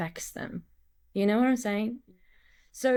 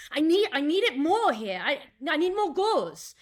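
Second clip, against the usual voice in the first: urgent; 6 semitones higher than usual. Shown as a demonstration. Actor on set begging for gauze, urgent and raised. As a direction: push the need, the wound is bleeding out. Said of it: "a little bit more urgency".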